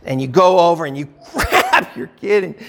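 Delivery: normal talking voice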